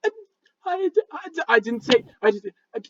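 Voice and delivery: high, broken stutter; squeaky embarrassed sputtering; flustered stammering. Acted. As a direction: start and fail to finish, voice jumping high. Embarrassed goblin stammers about bed sharing.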